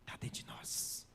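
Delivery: slow, intimate whisper; hushed revelation to the church. Real, not acted. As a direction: let the secret out in a slow whisper. Preacher reveals key to victory.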